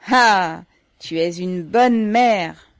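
loud, drawn-out cry of approval; delighted exclamation. A narrator's reading. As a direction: burst out, stretching the praise. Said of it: "Ivan s'écria".

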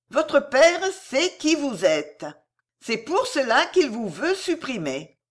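high, slow pronouncement; weighty, measured revelation; slow, deliberate. A narrator's reading. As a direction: let each word sink in. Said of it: "Lentement, comme pour bien faire pénétrer".

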